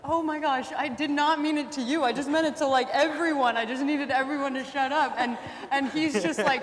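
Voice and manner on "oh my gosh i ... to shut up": higher, louder retold backpedaling; a touch of panic, over-explaining; flustered apology. Teacher backpedaling to crying pupil, mildly flustered.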